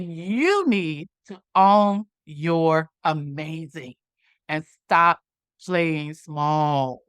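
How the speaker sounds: slow, deliberate phrases with pauses; slow, weighty advice. Retold or imitated. Young lady telling her to own it, slow and weighty. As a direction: let each piece land, dropping low at the end.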